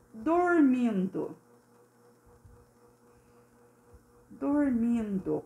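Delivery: very slow, stretched-out syllables; slow dictation. Shown as a demonstration. Teacher writing the word for pupils, slow dictation. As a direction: stretch the word as you write it.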